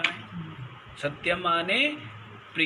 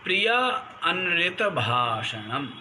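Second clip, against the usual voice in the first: normal talking voice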